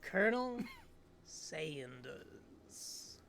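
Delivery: a hint of a smooth charmer; smooth suave voice. Student introduces himself smoothly.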